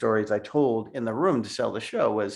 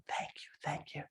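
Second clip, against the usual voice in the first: whispered thanks; 4 semitones higher, 17 dB quieter than usual. Retold or imitated. Old ladies thanking, whispered.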